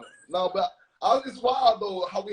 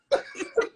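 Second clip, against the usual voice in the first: high laugh. Real, not acted. Host laughs at the joke.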